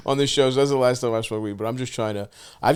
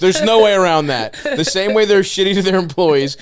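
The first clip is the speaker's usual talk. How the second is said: louder, higher joking rant; 6 semitones higher, 7 dB louder than usual. Real, not acted. Host loudly joking about Greek owners. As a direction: push it out, mock outrage.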